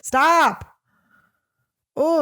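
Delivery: real irritated outburst at the phone; annoyed snap. Real, not acted. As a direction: cut the texting off, fed up. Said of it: "I'm getting fucking so mad".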